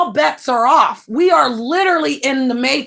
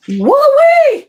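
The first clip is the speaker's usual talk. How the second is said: loud, very high whoop; whooping "woo-wee"; 7 dB louder than usual. Real, not acted. Reacting to Wombat's smell, high loud whoop. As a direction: whoop it out in mock disbelief. Exclaims over a bad smell.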